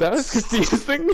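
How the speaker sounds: high laughing voice. Real, not acted. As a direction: still giggling, bemused. Laughing about the fanfic.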